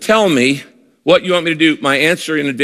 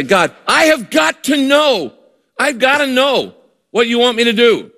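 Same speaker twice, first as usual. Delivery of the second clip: desperate cry to God; 8 semitones higher than usual. Acted. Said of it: "when you cry out to God".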